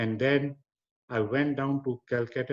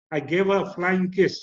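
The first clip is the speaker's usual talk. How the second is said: usual speaking voice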